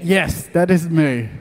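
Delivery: usual speaking voice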